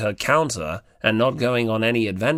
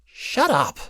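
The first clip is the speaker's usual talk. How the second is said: half-hearted shut up; 10 semitones higher than usual. Acted. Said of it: "a half-hearted".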